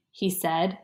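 ordinary delivery, nothing marked

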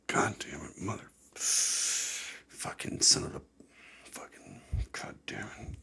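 very soft, whispered swearing; muttered cursing. Acted. Host acts out being pissed off after coffee, whispered cursing. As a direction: curse under your breath, drawn out.

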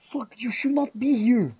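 ordinary delivery

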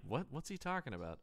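soft, quick puzzled aside; a hint of a baffled listener; neighbor wondering. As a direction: mutter it like a confused neighbor.

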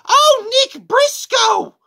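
very high, loud, slowed mock shriek; checkout lady. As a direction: belt the wrong name like a thrilled clerk. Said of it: "And she's like".

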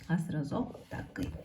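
ordinary talk

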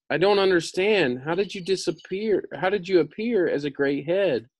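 put-on lifted high, girlish Dorothy; Dorothy's voice. A narrator's reading. Reader voicing Dorothy asking the wizard, pitched high. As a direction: pitch up high for the young girl.

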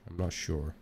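normal talking voice

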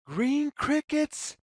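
usual speaking voice

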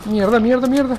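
shouted frustrated swearing; loud cursing. Real, not acted. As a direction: spit out the curses loud. Panicked cursing during gameplay. (maldiciendo a gritos)

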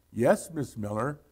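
plain reading voice

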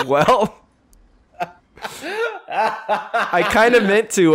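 slow words through laughter; laughing. Real, not acted. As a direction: say it while still laughing. Laughing after a crash.

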